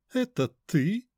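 high mannered lady's question; precious, affected society lady. A narrator's reading. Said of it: "высокий манерный голос". (высокий манерный голос)

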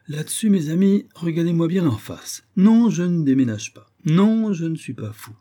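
high, vehement protest; emphatic insistence. Acted. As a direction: insist forcefully that you mean it. Insists to friends he is sane.

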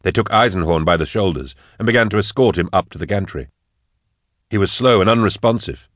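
plain reading voice

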